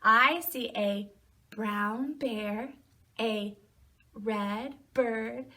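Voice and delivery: usual speaking voice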